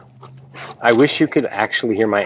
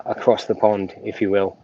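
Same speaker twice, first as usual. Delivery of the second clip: ordinary delivery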